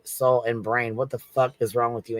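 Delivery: ordinary talk, nothing marked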